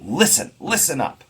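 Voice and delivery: LIS-ten punched high in "Listen, listen up"; strong first syllable. Shown as a demonstration. Teacher showing how the line draws us in, strong first syllable. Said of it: "with a strong first stressed syllable".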